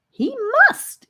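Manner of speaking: put-on high, loud small-boy insist; insistent. A narrator's reading. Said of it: "insisted Arlias".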